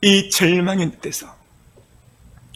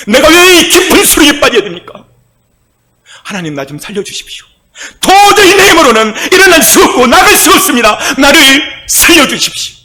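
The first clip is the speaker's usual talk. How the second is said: hammed-up wailing plea, much higher and louder; wailing prayer; 9 semitones higher, 5 dB louder than usual. Preacher acting David's desperate cry to God, hammed-up wailing. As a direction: cry out to be saved, in a mock lament. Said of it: "이렇게 통곡하며 부르신 거예요".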